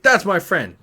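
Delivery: loud, high retold outburst; mock rage. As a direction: act outraged in defence of a friend. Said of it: "flies into a rage".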